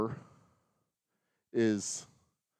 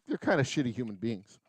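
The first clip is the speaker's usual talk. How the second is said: ordinary talk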